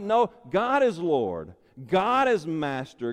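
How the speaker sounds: ordinary talk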